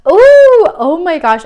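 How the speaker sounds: loud high "ooh", then dropping; real delighted surprise; astonished excitement. Real, not acted. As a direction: squeal the ooh in amazement. Struck by what comes in.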